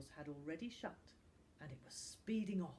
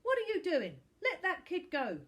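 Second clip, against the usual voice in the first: loud, high, furious yell; shouting from the car at two bullies holding a boy; angry shout; 12 semitones higher, 10 dB louder than usual. Acted. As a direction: bellow at them to stop.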